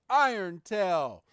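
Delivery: slow, loud battle call; calling an attack. Acted. Ash commands Pikachu's attack.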